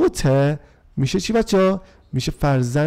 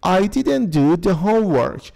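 slow model reading; 2 semitones higher, slower than usual. Shown as a demonstration. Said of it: "when وقتی که".

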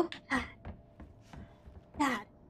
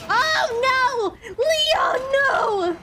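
panicked shout; 10 semitones higher, much slower than usual. Real, not acted. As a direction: yell out in panic for Leon. Character Leon falls in game.

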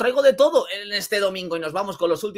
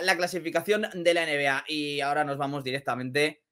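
ordinary talk, nothing marked

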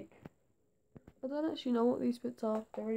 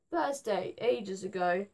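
ordinary talk, nothing marked

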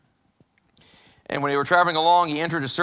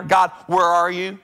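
pleading cry; 7 dB louder, slower than usual. Acted. Acted lonely prayer to God.

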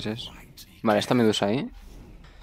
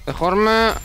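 shout; 13 semitones higher, 6 dB louder than usual. Real, not acted. Shouting at teammates mid-fight.

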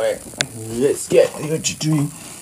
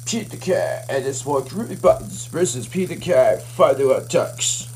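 ordinary talk, nothing marked

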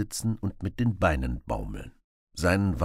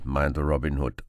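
plain reading voice

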